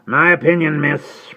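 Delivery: high, loud rough servant voice; Jerry Cruncher. Acted. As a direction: answer her like a loyal, plain-spoken servant. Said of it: "returned Jerry Cruncher".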